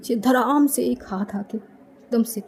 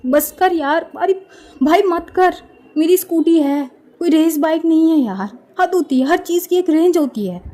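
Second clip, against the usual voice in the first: louder, higher exasperated yelling; shouting complaint; 6 semitones higher, 5 dB louder than usual. A narrator's reading. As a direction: pillion rider yells at cousin. Scolding reckless scooter rider.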